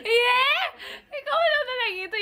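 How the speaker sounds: high, laughing squeal into quick speech; startled laughter. Real, not acted. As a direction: squeal and laugh while protesting. Startled laughter at creepy background talk.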